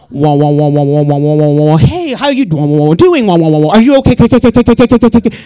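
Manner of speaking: loud, low garbled wah-wah babble; hammed-up freaked-out jabbering; paranoid stoned noises. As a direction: act out a paranoid stoner's brain melting.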